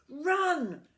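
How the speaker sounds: high, loud urgent shout; monkey shouting run; shouted warning. A narrator's reading. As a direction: yell at the children to flee. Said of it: "Mugglewump shouted to the children".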